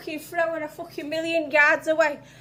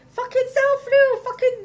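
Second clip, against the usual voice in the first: much higher, louder mock fanboy gush; 9 semitones higher, 6 dB louder than usual. Mocking a fan who raves about Evil Dead. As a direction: ham up an overexcited fan raving. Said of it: "that fucking guy when he says".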